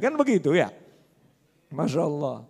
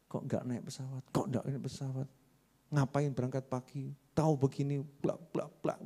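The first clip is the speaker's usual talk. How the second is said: much softer, muttered complaint under the breath; impatient grumbling; 3 semitones lower, 13 dB quieter than usual. Retold or imitated. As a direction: grumble to yourself, annoyed at the wait. Impatient passenger grumbling beside him. (penumpang tak sabar menggerutu pelan)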